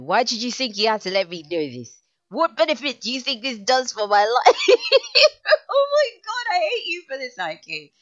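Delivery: loud, high wail breaking into laughter; laughing protest. Real, not acted. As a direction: scold while helplessly cracking up. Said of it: "I don't even know why I'm laughing".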